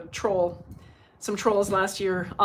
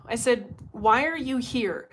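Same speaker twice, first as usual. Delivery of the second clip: normal talking voice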